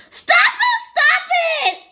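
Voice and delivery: very high, loud shriek; shrieked protest. Acted. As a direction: shriek for the attack to stop. Sister attacked with shampoo.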